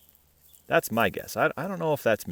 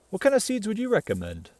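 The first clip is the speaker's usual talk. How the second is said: ordinary delivery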